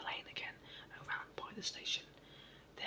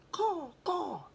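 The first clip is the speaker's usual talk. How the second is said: a sudden high, loud crow cry; crow caw; 9 semitones higher, 10 dB louder than usual. Acted. Jump talking nonsense to the station master, a crow's caw. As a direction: squawk it like a crow.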